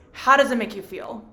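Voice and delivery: usual speaking voice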